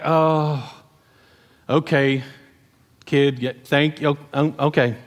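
low, halting, awkward acceptance; hesitantly accept, pitched a little down; mock sheepish acceptance. Men let a kid fight for them, mock sheepish.

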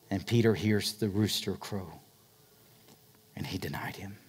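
soft breathy whisper; whispered pulpit hush. Acted. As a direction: drop to a whisper for the betrayal. Hushed climax of the denial.

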